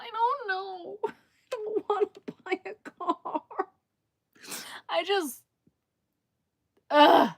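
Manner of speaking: high, tearful half-laugh on the verge of tears; laughing through real tears; wobbling between a laugh and a sob; laugh-crying. Real, not acted.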